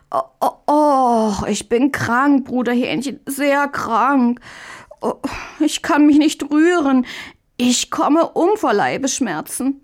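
high, whining sickbed voice; pitiful moaning. A narrator's reading. Möndlein feigning stomach pains to the brother, pitiful moaning. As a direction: play up the agony, groaning through the words. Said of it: "ein jämmerliches Stöhnen".

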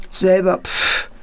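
ape the other one back with a scoffing pff; monkey mimicking. A narrator's reading. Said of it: "äffte der Affe nach". (spöttisch nachäffender Affe)

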